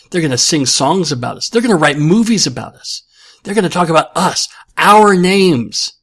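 rally the men before the charge; rousing battle speech. Acted. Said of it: "paraphrasing of Band of Brothers speech".

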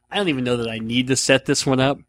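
ordinary talk

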